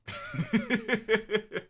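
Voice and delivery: high loud burst of laughter; big laugh. Real, not acted. Streamer laughing at chat's salmon comment, loud laugh. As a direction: crack up loudly.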